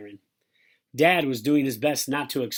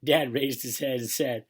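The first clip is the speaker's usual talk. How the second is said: usual speaking voice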